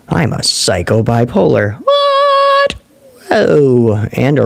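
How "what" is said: very high, drawn-out squeal of disbelief; mock shock. Host reacting to a viewer's chat comment, high mock-shocked squeal.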